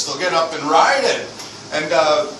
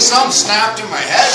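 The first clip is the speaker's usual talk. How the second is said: ordinary delivery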